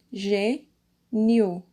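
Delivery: gê, nio with a clear pause between the syllables; separated syllables. Shown as a demonstration. Teacher splitting gênio for pupils, syllable by syllable. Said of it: "vocês vão separar".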